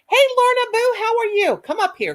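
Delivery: high, loud, bright welcome call; excited greeting. Real, not acted. Host calling a viewer up to the chat, excited and loud. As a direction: sing out a hello to a friend.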